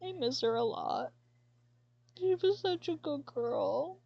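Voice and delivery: high, tear-choked pining; crying. Real, not acted. Owner remembering her cat, real crying voice. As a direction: ache for her lost cat in every word. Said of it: "Sorry, I'm crying".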